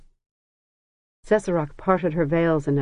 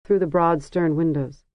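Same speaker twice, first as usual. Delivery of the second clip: usual speaking voice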